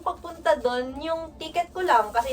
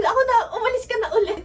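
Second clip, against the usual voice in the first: laughing quote; 8 semitones higher, 3 dB louder, faster than usual. Retold or imitated. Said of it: "Sabi ni Ate Jel".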